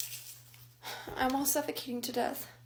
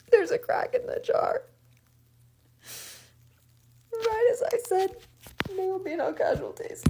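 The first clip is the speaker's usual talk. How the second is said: high-pitched, distressed whine; upset disbelief; 9 semitones higher, 5 dB louder than usual. Real, not acted. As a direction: voice jumps way up in dismay. Upset at finding the jar cracked.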